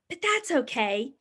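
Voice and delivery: plain reading voice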